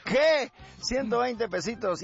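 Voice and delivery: high, acted norteño disbelief; asombro. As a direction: balk at the fare in mock shock. Passenger shocked by the fare. (asombro actuado, voz aguda)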